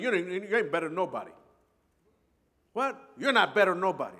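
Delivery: loud stern rebuke; stern father. Retold or imitated. Father scolding his bragging son, loud and stern. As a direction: cut the bragging kid down hard. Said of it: "And my father said".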